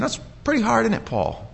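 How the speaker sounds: high start dropping into a tag question; knowing, sympathetic needling, pitch swooping down; gently chiding. Acted. God speaking to Paul about his struggle, gently chiding.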